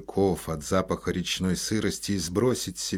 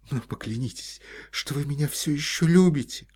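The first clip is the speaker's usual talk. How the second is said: higher, pleading, near tears; desperate plea; 8 semitones higher, 3 dB louder than usual. A narrator's reading. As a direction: beg him to swear it. Olga begs for love.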